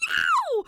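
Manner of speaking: very high, loud shriek of pain. A narrator's reading. Ghost shrieking in a tantrum at Danny. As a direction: let out a piercing ow. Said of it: "she shrieks".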